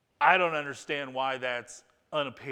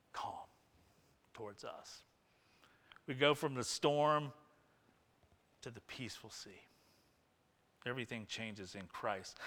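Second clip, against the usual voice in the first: hushed awe; 5 semitones lower, 7 dB quieter than usual. Real, not acted. Pastor explaining propitiation to the church, hushed. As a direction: let it settle into quiet.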